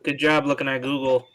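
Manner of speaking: normal talking voice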